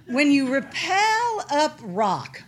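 high, loud, slow mock lecturing; hammed-up instructor imitation, stretching the words; know-it-all. Sister lectures on climbing, mock know-it-all. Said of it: "in her know-it-all-ness".

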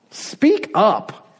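exasperated demand. Acted. Acted demand to a whispering God.

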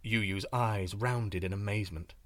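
ordinary delivery, nothing marked

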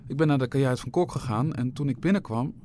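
ordinary delivery, nothing marked

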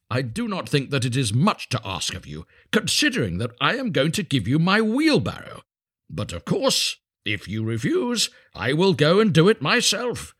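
huffy, self-righteous miller; guilt-trip him, rising to a high finish; affronted miller. A narrator's reading.

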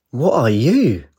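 a playful, goofy captain asking; comical voice. Acted. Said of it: "the captain said in a comical voice".

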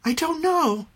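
put-on high, small child's voice; a little boy admitting he doesn't know; young boy. A narrator's reading. Boy quizzed by his mother, high child voice.

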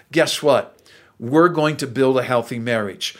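usual speaking voice

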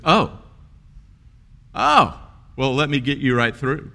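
high, loud mock "oh" settling into helpfulness; imitated secretary, suddenly impressed and obliging; sudden recognition. Secretary hearing the boss's name, surprised and eager to help.

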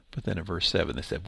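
ordinary talk, nothing marked

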